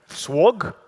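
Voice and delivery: swag with a rounded "aw" vowel, rising; unsure pronunciation attempt. Shown as a demonstration. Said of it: "How should I say it?".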